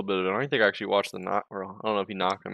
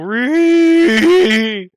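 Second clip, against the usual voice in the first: mock shriek; 14 semitones higher, 13 dB louder than usual.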